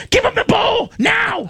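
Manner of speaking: high, urgent shout; mock panicked shout; shouting demand. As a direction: yell it at the handlers to give the bowl back. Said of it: "I complained".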